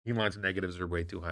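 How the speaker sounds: quiet, scornful repeat of the critics' line; soft sarcastic echo of a complaint; mocking echo. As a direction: parrot the claim back with disdain. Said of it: "What are you talking about?".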